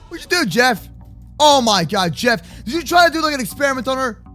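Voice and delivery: ordinary talk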